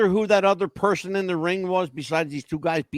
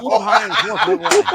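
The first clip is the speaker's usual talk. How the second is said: laughing; 10 semitones higher, 4 dB louder than usual. Real, not acted. Joke punchline.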